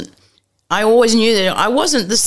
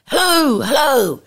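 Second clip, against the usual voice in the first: a lightly voiced small faraway caller; mock a tiny voice on a bad line; little distant voice; 6 semitones higher than usual. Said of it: "this little voice at the end going".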